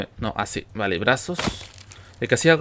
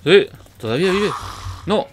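being chased; 6 semitones higher, 7 dB louder than usual. Real, not acted. Enemy still alive, fight.

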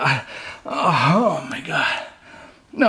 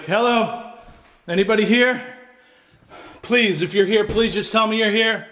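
calling out; 10 semitones higher than usual. Real, not acted. Calling out in dark house.

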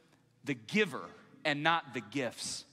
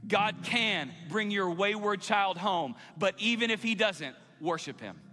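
full-force preaching; 6 semitones higher, 5 dB louder, slower than usual. Real, not acted. Preacher urging church to worship even if, full-force. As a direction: drive each promise home to the congregation.

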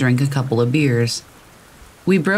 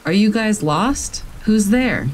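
mild shout; 6 semitones higher than usual. A narrator's reading. Camper challenges lost strangers outside his tent, mild shout. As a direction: call out higher, keeping the volume contained. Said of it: "I shout again".

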